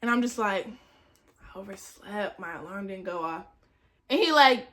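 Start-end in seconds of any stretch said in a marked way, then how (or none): 1.4-3.4 low, very soft confession; mumble-soft, owning up to authority; meek excuse. Retold or imitated. Student explains lateness to head of class, meek and quiet.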